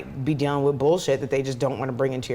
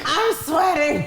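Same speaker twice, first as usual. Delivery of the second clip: high, giggly complaint; real embarrassed laugh; laughing flustered; 10 semitones higher, much slower than usual. Real, not acted. Embarrassed after phone mishap.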